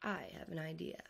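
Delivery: hushed secret whisper. Acted. Dad whispering his idea to the family. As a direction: breathe it like a secret plan.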